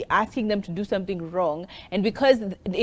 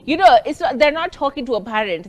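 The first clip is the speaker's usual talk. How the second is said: ordinary delivery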